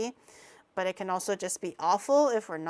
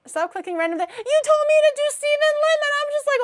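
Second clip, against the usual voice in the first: indignant; 11 dB louder, faster than usual. Real, not acted. Defending herself to chat.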